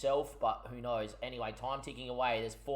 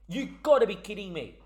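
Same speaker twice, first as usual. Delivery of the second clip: ordinary talk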